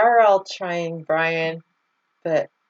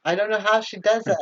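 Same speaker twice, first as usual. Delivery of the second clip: usual speaking voice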